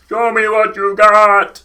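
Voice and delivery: loud, high, slow orc bellow; orc shout. Retold or imitated. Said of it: "how do you do the voice?".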